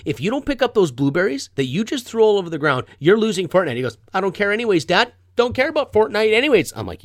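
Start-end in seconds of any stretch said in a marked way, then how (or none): 0.1-3.8 loud, high parental ultimatum; acted-out angry dad warning; threatening. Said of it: "I start threatening him instantly". 4.1-6.7 loud, high imitated backtalk; mock indignant son; defiant kid. Son defying dad in quarrel.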